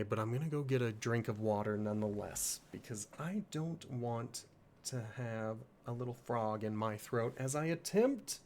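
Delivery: ordinary talk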